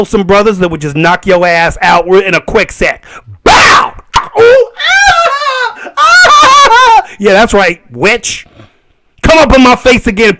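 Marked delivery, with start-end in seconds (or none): 3.4-7.1 high shouted punch and pain cries; punch sound effects. Pretend. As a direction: act out the knockout with yelps. Acting out a knockout punch.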